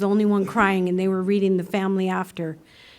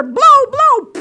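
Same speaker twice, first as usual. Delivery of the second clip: mock cop urging; 9 dB louder than usual. Mimicking cops demanding breathalyzer.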